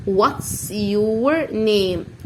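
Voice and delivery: What's your name drawn out word by word; very slow model phrase. Shown as a demonstration. Teacher to pupils, very slow model to repeat. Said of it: "قول معايا تاني".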